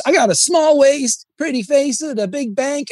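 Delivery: high, sing-song R&B diva voice; imitating female R&B singer. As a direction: mimic the girl in the song, up high. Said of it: "it's like a female R and B song".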